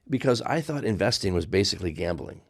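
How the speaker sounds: normal talking voice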